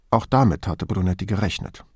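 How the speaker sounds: plain reading voice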